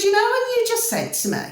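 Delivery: high-pitched, indignant retold challenge; retold confrontation. Woman confronting her new neighbour over his remark, indignant. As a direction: take him up on it, pitched high.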